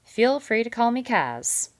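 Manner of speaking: loud, hearty big-fellow voice; hearty, loud beaver voice. A narrator's reading. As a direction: boom it out like a jovial salesman. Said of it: "with his usual heartiness".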